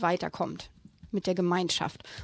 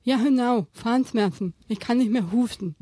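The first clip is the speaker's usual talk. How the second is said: shark babbling; 5 dB louder than usual. Acted. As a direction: blurt it out like a hurting shark. Shark character continues.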